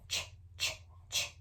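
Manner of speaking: unvoiced ch, ch, ch, repeated on its own; isolated ch sound. Shown as a demonstration. Teacher isolating the first sound of chowder for kids, soft and breathy. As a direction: hiss the ch sound three times, soft. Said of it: "start with chow".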